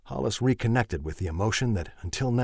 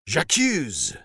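accusing declaration; 6 semitones higher than usual. Acted. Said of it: "it seemed to declare".

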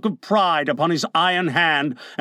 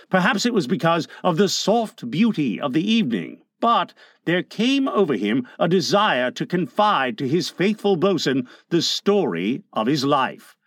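ordinary delivery

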